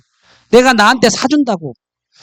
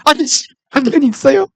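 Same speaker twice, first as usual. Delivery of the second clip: higher rabbit asking with a sly rising end; smug rabbit voice; 6 semitones higher than usual. Acted. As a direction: deliver the punchline like a cheeky rabbit. Punchline rabbit.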